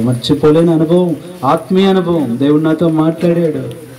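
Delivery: high, rising sermon testimony; fervent preaching. Real, not acted. Preacher declaring that God visited and touched him, fervent and building. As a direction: proclaim it with mounting fervour.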